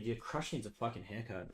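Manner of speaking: ordinary talk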